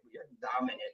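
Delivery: high, near-whispered tease; a soft high echo of the tease; breathy mock aside. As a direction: hush it and float it up. Teasing a commenter's daughter.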